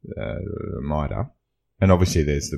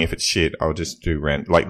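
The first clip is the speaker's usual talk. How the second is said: normal talking voice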